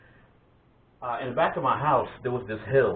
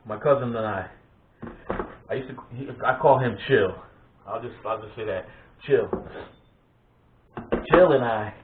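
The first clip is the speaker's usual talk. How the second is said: ordinary delivery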